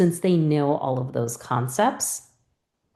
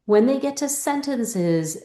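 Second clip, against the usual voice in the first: ordinary delivery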